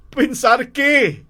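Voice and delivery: high, loud, drawn-out question; alarmed. Acted. Husband questions his frantic wife, alarmed. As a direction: react with open alarm.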